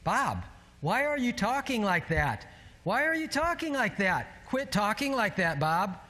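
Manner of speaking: high-pitched, insistent inner voice; alarmed self-scolding. Retold or imitated. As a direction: plead with yourself in exasperation. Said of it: "in my brain, I'm thinking".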